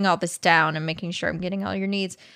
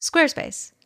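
slogan read; 8 semitones higher than usual. Shown as a demonstration. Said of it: "that's their new slogan".

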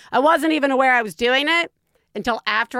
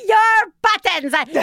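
slow, high robot voice; 9 semitones higher, 5 dB louder, slower than usual. Pretend. Playing with a robot's buttons.

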